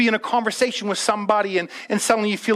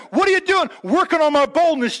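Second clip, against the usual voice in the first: mock exchange; 7 semitones higher than usual. Preacher mocking a believer pumping up with music, high playful voice. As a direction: play it as a silly bystander asking.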